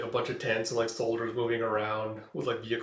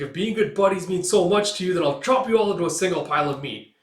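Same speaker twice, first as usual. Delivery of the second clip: higher, louder threat; menacing threat; 5 semitones higher, 6 dB louder than usual. Acted. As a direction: promise to chop them up. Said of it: "It's like".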